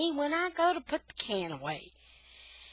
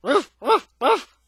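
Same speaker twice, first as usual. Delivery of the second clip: loud, high imitated dog barks; dog barking; 6 semitones higher, 10 dB louder than usual. A narrator's reading. Dog excited by the mower, imitated barking. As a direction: bark it out in three sharp bursts. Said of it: "He just sits there and barks".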